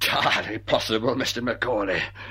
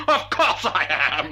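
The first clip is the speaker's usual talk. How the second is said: laughing; 4 dB louder, slower than usual. Acted. Madman laughing at accusation.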